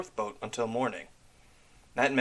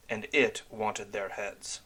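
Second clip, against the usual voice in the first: ordinary delivery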